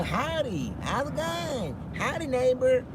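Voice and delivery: high, drawn-out cheery neighbor greeting; Ned Flanders. Acted. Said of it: "Ned Flanders type of thing".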